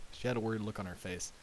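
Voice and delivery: ordinary delivery, nothing marked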